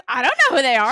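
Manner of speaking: loud, brusque mom impression; dismissive mom. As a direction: belt out mom's refusal. Mom rejecting unknown caller.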